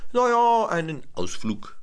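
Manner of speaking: high squeaky monkey "na ja"; cheeky monkeys. A narrator's reading. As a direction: play innocent as the monkeys. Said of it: "sagen die Affen".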